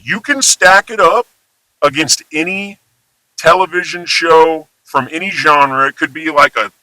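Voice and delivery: ordinary talk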